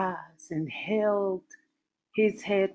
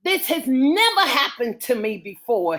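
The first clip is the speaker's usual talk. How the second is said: louder, higher distressed protest; he's rattled and can't hide it; upset protest; 5 semitones higher, 6 dB louder than usual. Acted. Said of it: "he said gently".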